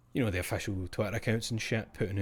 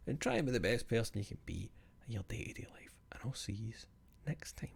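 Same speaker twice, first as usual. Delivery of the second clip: whispered and much softer; soft sign-off whisper; 7 dB quieter than usual. Real, not acted. The streamer whispering goodbye to viewers at the end. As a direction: breathe the goodbye out gently. Signing off softly.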